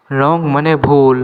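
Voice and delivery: ordinary talk, nothing marked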